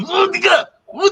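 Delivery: put-on high-pitched echo of a Russian drunk; playful copy of the caller's impression; Nikolai Blensky. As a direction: chime in with a mock Russian cry. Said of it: "he's a Jack Russian".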